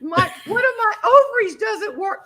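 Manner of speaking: blurting it out through sobs; crying so hard. Retold or imitated. Wife to stranger at stockyard, high tearful cry. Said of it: "And I started crying so hard".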